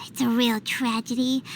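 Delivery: sigh, then a low breathy whisper; hammed-up despair; mock tragic whisper. Paimon lamenting no kimonos, mock tragic whisper.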